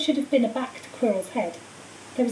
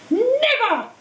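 defiant cry; 11 semitones higher, 3 dB louder than usual. A narrator's reading. Harry defiant.

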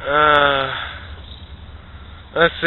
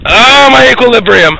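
dizzy yell; 9 semitones higher, 6 dB louder, slower than usual. Real, not acted. Dizzy on spinning contraption.

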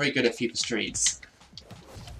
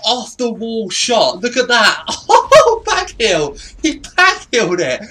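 excited raving; 9 semitones higher, 7 dB louder than usual. Real, not acted. Gamer raving about an off-the-wall goal, hyped yelling. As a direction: lose it over a crazy goal.

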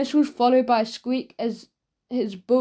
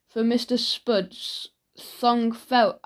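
plain reading voice

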